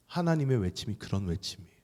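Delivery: ordinary talk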